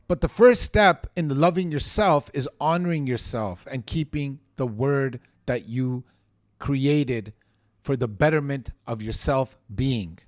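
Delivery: ordinary talk, nothing marked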